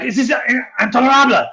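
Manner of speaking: high-pitched outraged cry, rising in force; hammed-up furious protest; indignant scream. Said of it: "screaming like".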